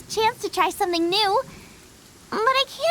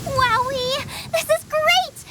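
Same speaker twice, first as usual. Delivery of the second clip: thrilled cheer; 6 semitones higher, slower than usual. Acted. Xiangling seeing the raw meat, thrilled. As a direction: burst with joy at the meat.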